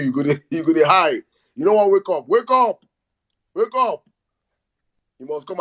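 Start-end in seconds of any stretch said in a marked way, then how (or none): 1.5-4.0 wake up call. Real, not acted. Calling on Tinubu to wake.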